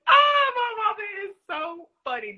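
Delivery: high, squealing laughter through words; laughing hard at mom's antics. Real, not acted. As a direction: crack up while talking.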